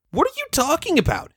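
Rainbow Dash voice. Acted.

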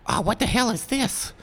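high, startled goblin voice; waking goblin. Acted. Goblin waking to find three intruders, high voice. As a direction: squawk as a goblin roused from sleep. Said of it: "and he goes".

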